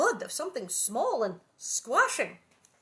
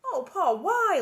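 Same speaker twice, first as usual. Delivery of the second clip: high, loud plea to a father; dismayed child; 9 semitones higher, 10 dB louder, slower than usual. A narrator's reading. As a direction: wail the question in dismay. Laura's dismayed question in the story.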